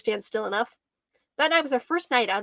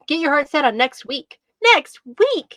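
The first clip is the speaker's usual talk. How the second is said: loud bark building to higher pitch; exasperated, louder and louder; 3 semitones higher, 7 dB louder, slower than usual. A narrator's reading. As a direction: bark it out, punching the repeat harder. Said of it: "she barked".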